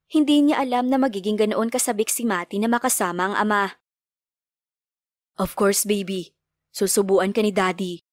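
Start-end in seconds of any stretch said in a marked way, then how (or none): 5.4-8.0 a hint of a lower man's voice; reply quickly like a gentle father; father answering. A narrator's reading. Father answering his child, lightly lower. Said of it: "Mabilis namang sagot ni Theo".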